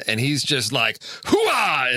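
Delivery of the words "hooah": mock shout, pitched up; a lightly hammed-up outburst, higher than usual; Al Pacino up to eleven. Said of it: "like, Al Pacino up to eleven".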